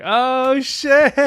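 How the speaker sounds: high, loud hype for a fresh haircut; mock excited "oh shit" at a friend's fresh cut; hyped-up exclamation. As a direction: hype your boy up, voice shooting up. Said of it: "you're like".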